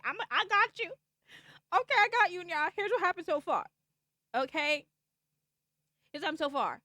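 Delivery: excited promise to catch viewers up. Real, not acted. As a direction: pump up the chat. Excited reassurance to chat.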